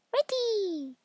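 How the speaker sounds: high squeaky pig voice; eager little pig. Acted. The pig answers.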